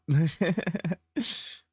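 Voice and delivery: short high laugh; light laugh; sleepy laugh. Real, not acted.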